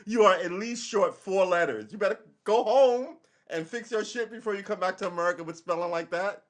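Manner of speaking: high-pitched, exaggerated comic rant; mock scolding. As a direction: scold the friend in hammed-up indignation. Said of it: "It's like".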